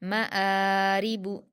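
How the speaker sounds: मआरिबु slowly, quick म, long held आ; short म, then stretch आ in मआरिबु; stretched hamza. Shown as a demonstration. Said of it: "हमज़ा को खींचेंगे".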